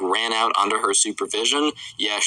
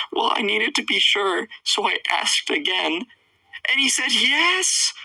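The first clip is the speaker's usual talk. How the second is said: high put-on upset kid voice; Trevor; 12 semitones higher than usual. Boy admits he asked the app twice, mock upset. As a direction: draw the ending out high, like a distressed child. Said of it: "Trevor's like".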